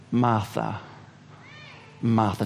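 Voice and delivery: low, soft, slow name calling; gentle calling. Acted. Jesus gently calling Martha by name to settle her, soft and low. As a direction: call her name tenderly to calm her. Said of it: "He simply says her name twice".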